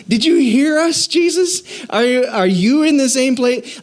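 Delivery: high disbelieving question, voice jumped up; incredulous disciples. Retold or imitated. Disciples questioning Jesus after his answer, high incredulous. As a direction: sound like you can't believe he said that.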